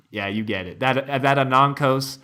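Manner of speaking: normal talking voice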